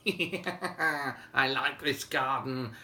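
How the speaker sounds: slow, drawn-out mischievous frost spirit; slowly gloating Jack Frost; Jack Frost's voice. Acted. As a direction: stretch every word like a sly winter spirit. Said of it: "old Jack Frost himself said".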